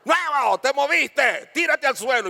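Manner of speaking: high, loud drill instructor scolding; yelling instructor. Retold or imitated. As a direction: snap at the recruit who moved. (instructor gritándole al cadete)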